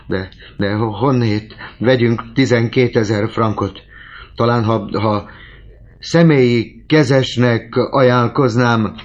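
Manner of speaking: put-on high, loud stammering old man, slowing at the end; desperate old father. A narrator's reading. Old father frantically offers to stand surety, high and loud.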